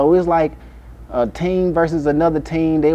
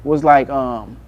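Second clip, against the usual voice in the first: ordinary delivery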